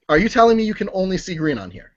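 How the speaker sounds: usual speaking voice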